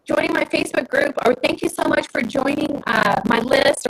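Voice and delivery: high, bright welcome greeting; cheerful video host. Retold or imitated. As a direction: sing out a friendly thank-you. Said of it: "You saying".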